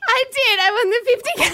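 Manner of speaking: high, tearful joy breaking through; overwhelmed, crying with joy; happy tears. Real, not acted. Sister doubts, she insists, crying.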